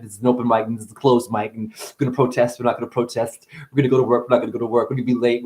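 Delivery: normal talking voice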